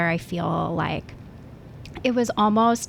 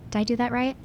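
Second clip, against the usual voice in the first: ordinary delivery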